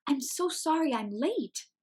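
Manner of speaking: high, breathless rush; out of breath. Acted. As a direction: gasp it out after running. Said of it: "still catching her breath".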